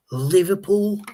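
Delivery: put-on Liverpool, jokey Scouse accent; mock Scouse Liverpool. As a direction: have a go at saying Liverpool like a Scouser. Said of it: "How good will our accent".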